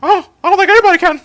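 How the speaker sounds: cartoon mouse falsetto taking up the dare; Mickey Mouse voice. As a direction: shoot up into a cartoon mouse squeak. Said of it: "I'm not doing Mickey Mouse at this hour".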